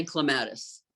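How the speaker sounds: clematis stressed on the middle syllable, cluh-MAT-iss; British friends' pronunciation. Shown as a demonstration. Telling the host how British friends say it, clematis as cluh-MAT-iss. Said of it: "most of my British friends will say".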